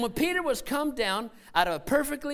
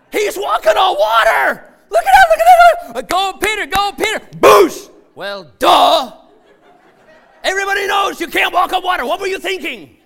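shrieking at Peter walking on water, much higher and louder; astonished shout; 12 semitones higher, 20 dB louder than usual. Acted. Disciples in the boat shouting at Peter in disbelief.

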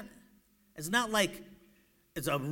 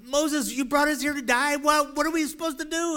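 high, loud mock-panicked wail; hammed-up crowd protest; panicked complaint; 10 semitones higher, 7 dB louder than usual. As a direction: accuse your leader in rising alarm. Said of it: "that's what they were saying".

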